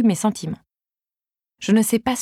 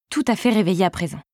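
ordinary delivery

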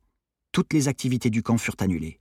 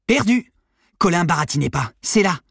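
high, loud burst of excitement; excited cry; 9 semitones higher, 6 dB louder than usual. A narrator's reading. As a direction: cry out on finding the proof. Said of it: "il s'écria excité". (cri excité de découverte)

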